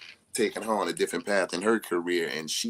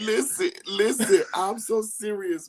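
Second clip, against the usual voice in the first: insistent; 12 semitones higher, 4 dB louder than usual. Real, not acted. Insisting through laughter.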